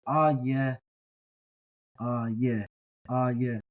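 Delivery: aye, aye, aye, slowed into a y sound like yes; soft y sound. Shown as a demonstration. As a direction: repeat aye slowly, gliding in a y. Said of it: "really sounds like the word yes".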